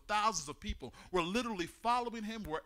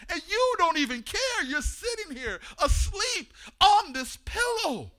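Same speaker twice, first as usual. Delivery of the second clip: loud, higher, frightened plea to the teacher; terrified shout; 8 semitones higher, 4 dB louder than usual. Acted. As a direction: shout it like men about to sink. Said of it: "They're shouting, they're screaming, they're terrified".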